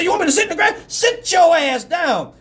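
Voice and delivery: high, hollered exchange; retold exasperated shouting; shouted argument. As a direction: act out a yelled standoff. Heated argument over sitting down.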